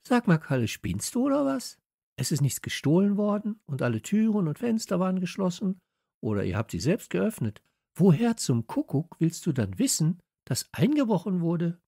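put-on high, sceptical girl's voice; indignant high character voice; girl challenging a boy, indignant. A narrator's reading.

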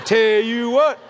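Texan drawl. Retold or imitated. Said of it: "That's all you're getting out of a Texan".

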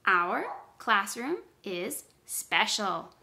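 slow, separated words for counting. Shown as a demonstration. Teacher counts words with pupils, slow word by word. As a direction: pause after every word. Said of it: "Let's count the words".

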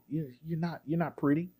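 hushed, imitated calm put-down. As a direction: say it very softly, calmly, as his impression. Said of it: "Said it very calmly".

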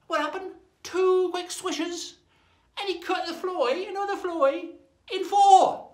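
high, drawn-out amazement; excited storytelling. A narrator's reading. Storyteller reveals the second pirate's feat, high excitement. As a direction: build high to a loud last word.

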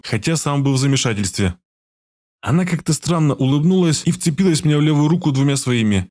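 ordinary talk, nothing marked

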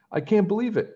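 the prince realising he found a real princess; excited cry. A narrator's reading. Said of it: "cried the prince".

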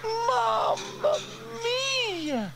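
very high, loud wail of dismay; high, despairing character cry; Bella wailing. A narrator's reading. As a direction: wail it out at the top of the voice. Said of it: "wailed Bella".

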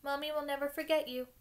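put-on lightly sobbed farewell to a baby; tearful goodbye. A narrator's reading. Hagrid saying goodbye to Norbert, mildly tearful. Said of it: "Hagrid sobbed".

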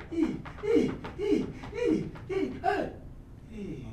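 soft pained moaning; groaning in pain. Acted. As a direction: moan as if hurting.

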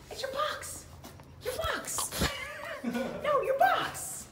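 very high giggly squeal; high giggling dog talk; laughing squeal. Real, not acted.

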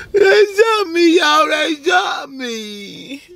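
high, slow wailing fake sobs; fake crying. Practicing being shot for prank.

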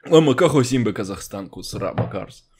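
put-on thick Russian accent on Hebrew. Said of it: "מבטא רוסי".

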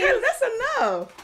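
high-pitched mock scold; high scolding call. Real, not acted. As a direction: call her off sharply, high. Stopping Kayla.

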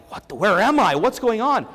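reacting in disbelief, voice up; startled confusion. To the therapist after his head slam, baffled.

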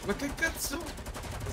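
surprised outburst. Real, not acted. Shocked in game.

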